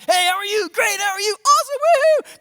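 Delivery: high, loud, over-bright mock church chatter; fake cheery greetings. Preacher mocking churchgoers' fake cheer, high and loud. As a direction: ham up the dressed-up Sunday smile.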